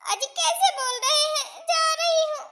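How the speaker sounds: high, loud wounded whine; hurt, tearful protest. Acted. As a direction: give in, upset and close to tears. Wife hurt by husband's threat.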